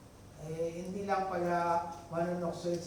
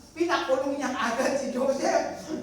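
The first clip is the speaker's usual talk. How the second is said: emphatic; 7 semitones higher, 8 dB louder than usual. Real, not acted. Preacher stressing haste.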